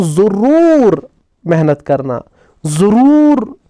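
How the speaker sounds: teacher stressing ज़रूर to show an emphasis word; emphatic urging. Shown as a demonstration. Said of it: "मैं आपको बोलता हूँ".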